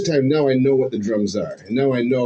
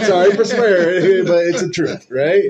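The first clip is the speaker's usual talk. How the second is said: laughing apology; 5 semitones higher, 4 dB louder than usual. Real, not acted. Laughs after swearing.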